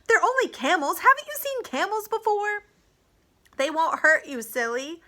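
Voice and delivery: high, loud cry of exasperation; shouted scolding. A narrator's reading. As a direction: call out sharply to a startled horse.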